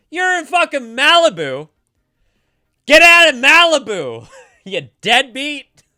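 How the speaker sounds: a hammed-up shout at a viewer from Malibu; mock yell. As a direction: bellow at them in jest. Teasing chatter from Malibu.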